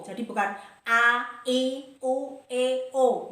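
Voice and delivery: mock bad vowel shapes; wrong vowels. Shown as a demonstration. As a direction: spread lazy vowels, the wrong way. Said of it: "Bukan seperti itu ya".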